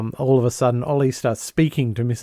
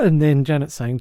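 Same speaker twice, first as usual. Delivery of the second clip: usual speaking voice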